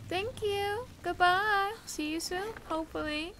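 high sweet voice for the golem; cutesy voice. Acted. Gamer greeting the little golem in a high cutesy voice. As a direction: talk to it like a cute pet.

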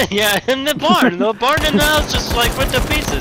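high, loud, amazed laughing report; excited laughing. Real, not acted. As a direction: describe the blast in disbelief. House and barn just exploded.

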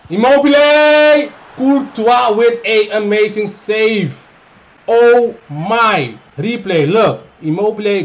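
loud, slow, high call; excited commentator shout. Real, not acted. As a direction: shout the name like a goal call. Big shot and save.

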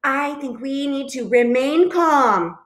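high, insistent half-yell; yelling back. A narrator's reading. Goose answers panicking Duck, yelling back. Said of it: "Goose yelled back".

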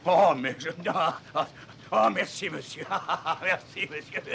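high, loud, laughing thanks; overjoyed. Acted. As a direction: gush with delighted laughter. Captain overjoyed by the deal.

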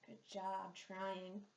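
ordinary talk

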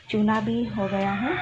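normal talking voice

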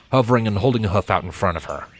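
plain reading voice

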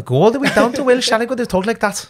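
high, sing-song Welsh accent. Shown as a demonstration. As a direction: slide up into a put-on Welsh accent. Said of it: "Do the Welsh again".

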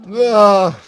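high loud "ah"; startled yell. Real, not acted. Player reacting mid-game, a high sudden yell.

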